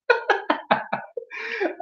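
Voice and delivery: a high, soft breathless laugh. Real, not acted. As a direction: crack up quietly at yourself. Cracks up at own imagination.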